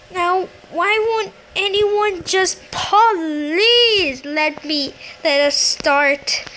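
high, whiny exasperation; annoyed. Real, not acted. Player kept waiting for the game to start, exasperated. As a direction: plead and protest, stretching words, climbing in pitch. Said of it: "I'm so annoyed right now".

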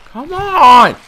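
high, loud exasperated cry; frustrated shout. Real, not acted. Gamer yelling at his bike, high exasperated shout. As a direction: yell it at the screen.

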